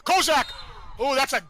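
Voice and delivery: a loud, high burst on the name; sudden shout. Real, not acted. Commentator seeing Kozak break in, sudden shout. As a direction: yell the name as she breaks through.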